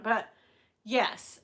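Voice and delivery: usual speaking voice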